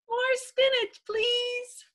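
high little-pea voice; eager child. Acted. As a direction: pipe it up high and hopeful.

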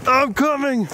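high-pitched worried call. Real, not acted. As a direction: call out that help is on the way. Calling to stuck companion.

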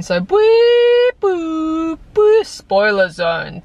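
playful high, loud siren sound before spoiler zone; silly siren noise. Pretend. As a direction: hoot a goofy alarm, then slow down. Announcing the spoiler section.

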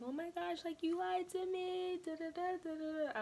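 high-pitched hammed-up wail of betrayal; dramatic outburst. Sister wailing at brother over his tattoo, high and dramatic. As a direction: overplay the hurt, voice jumping way up. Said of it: "I was so dramatic back then".

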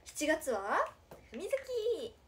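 idol catchphrase, stretched and sung out at the end; catchphrase call. Shown as a demonstration. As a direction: chant it out, drawing out the name. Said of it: "ってやつやろ？".

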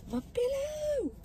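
high, drawn-out squeal; a soft, slow squeak of distress; high whine. Real, not acted. Whining over a lost pillow.

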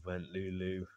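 put-on expressive read-aloud for a child, with pauses; bedtime story narration. A narrator's reading. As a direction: read a picture book at bedtime.

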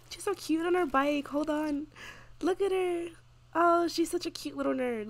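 high-pitched fond cooing; gushing. Real, not acted. Player to her Sim on the bike, gushing high. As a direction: coo over something adorably cute.

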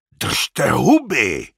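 loud, drawn-out yell; roaring. A narrator's reading. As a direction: bellow slowly to silence the crowd. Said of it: "zařval Ilja".

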